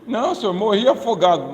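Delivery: dead man answering God at heaven's gate; drowned man's excuse. Acted. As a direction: explain yourself to God.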